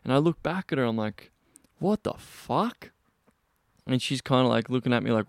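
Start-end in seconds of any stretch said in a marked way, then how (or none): 1.8-2.9 drawn-out, rising disbelief; slow, incredulous question pitched high; taken aback. Retold or imitated. Man turns on the woman who shoved him, incredulous. Retold shock at being shoved.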